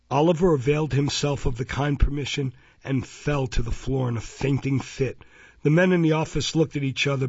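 plain reading voice

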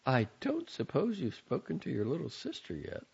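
mother nudging her son about his sister; prodding mother. A narrator's reading. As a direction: fish for an answer.

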